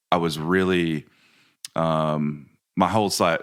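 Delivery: ordinary delivery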